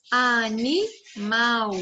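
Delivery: animal very slowly, drawn out to a long MAL; stress on final syllable. Shown as a demonstration. Teacher showing children the stronger syllable, animal slowly with weight on MAL. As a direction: sound out a-ni-MAL, stretching each syllable. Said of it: "ele tem mais força".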